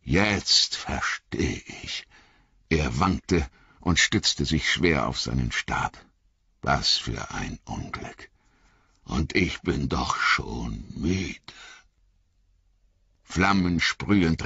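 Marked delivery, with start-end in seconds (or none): 0.0-11.4 put-on lower, slow, softer tired old wizard; old wizard lamenting his weariness; weary Gandalf. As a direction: sigh it out, worn and grave. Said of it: "murmelte Gandalf".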